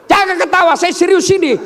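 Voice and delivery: high-pitched comic protest; mock scolding. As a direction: scold the laughing crowd in pretend indignation. Mock scolding of laughing audience. (pura-pura marah, nada melengking tinggi)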